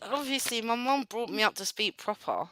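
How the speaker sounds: soft, proper British accent; subdued posh British delivery. Shown as a demonstration. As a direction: speak quietly in a proper, well-brought-up accent. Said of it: "I didn't always speak like this".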